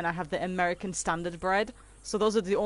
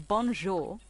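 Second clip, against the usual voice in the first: posh-sounding French bonjour; 3 dB quieter, slower than usual. Shown as a demonstration. Streamer practising bonjour for a viewer, posh French accent. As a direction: try bonjour in careful French. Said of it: "That sounds a bit posh".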